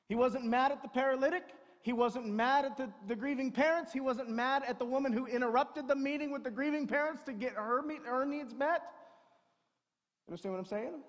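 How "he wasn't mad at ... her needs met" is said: loud, high, driving sermon voice; a pastor at full volume building to a peak; preaching at full force. Acted. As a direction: preach it out over the whole room. Preaching to the congregation.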